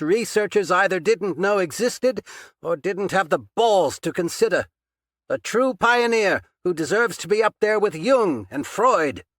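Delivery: strained. A narrator's reading. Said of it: "Patchen's reply sounded strained".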